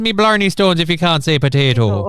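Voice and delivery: high-pitched stage-Irish teasing; Irish accent. Acted. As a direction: tease in a broad, lilting Irish accent. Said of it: "in an Irish accent".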